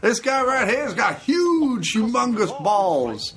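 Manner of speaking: high, slow mock announcer; laughing imitation. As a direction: ham up the line while laughing. Imitating the film line, laughing.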